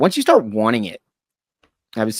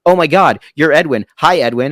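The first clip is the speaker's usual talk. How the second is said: higher, louder starstruck gush; obnoxious gushing fan; 5 semitones higher, 6 dB louder than usual. Retold or imitated. Pushy fan shouting his character name at the bar, loud and gushing. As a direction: gush over him like an overexcited fan. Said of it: "Versus, like".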